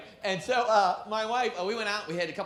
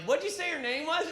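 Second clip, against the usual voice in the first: high, tipsy slurred wife; drunk wife; 9 semitones higher than usual. Retold or imitated. Wife on the floor, dazed after a fall, high tipsy voice. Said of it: "She's like".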